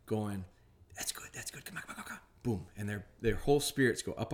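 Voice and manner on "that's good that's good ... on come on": soft, high, eager coaxing; whisper-cheer the dog in after the shot; hushed hype. Retold or imitated. Trainer encouraging dog after gunshot, hushed eager coaxing. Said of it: "followed up real quickly with me going".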